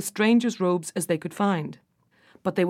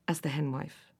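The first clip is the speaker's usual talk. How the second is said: ordinary delivery, nothing marked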